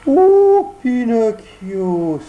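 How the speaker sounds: sudden high wail of the name; fox cries out. Acted. Fox hails Pinocchio with a loud whining cry. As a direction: cry out in mock grief. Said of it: "s'écria le renard".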